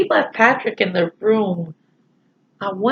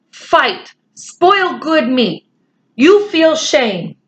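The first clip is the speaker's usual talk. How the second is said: slow, higher, stern Indian chief voice in broken English; Little Bear scolding; 8 semitones higher, much slower than usual. Acted. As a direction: scold the boys like a proud little warrior.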